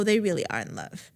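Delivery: ordinary delivery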